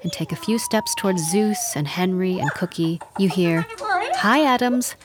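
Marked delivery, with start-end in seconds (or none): 4.2-4.9 high rising parrot-like hi; rising parrot call. Acted. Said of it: "rising louder and faster".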